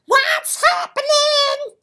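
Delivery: high, loud hearty shout; booming hello; loud greeting. Acted. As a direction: bellow it like a big cheery bird. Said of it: "and a loud".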